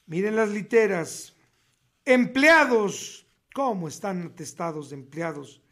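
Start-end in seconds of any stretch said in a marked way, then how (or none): none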